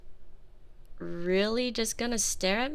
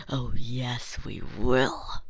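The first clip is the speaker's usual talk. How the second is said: much lower, dark and certain; sinister low voice; 13 semitones lower, slower than usual. Acted. Girlfriend darkly promising her captive, much lower. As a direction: sink deep into menace.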